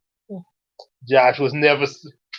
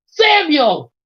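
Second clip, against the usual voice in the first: high, louder call up the stairs; mother calls her son from downstairs; calling upstairs; 10 semitones higher, 5 dB louder than usual. A narrator's reading. Mother calling son down from downstairs, loud high call. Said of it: "called Lisa from downstairs".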